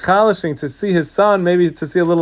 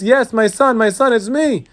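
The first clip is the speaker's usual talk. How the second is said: higher-pitched, eager call; excited father; 8 semitones higher than usual. Acted. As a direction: cry out to your son in mild joyful excitement. Father joyfully answers his son.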